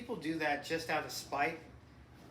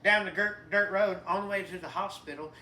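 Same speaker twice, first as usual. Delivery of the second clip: put-on light Southern country accent, a little louder; 3 semitones higher, 9 dB louder than usual. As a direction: play a good-ol'-boy Southern mechanic named Jimmy. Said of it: "started talking with a southern accent".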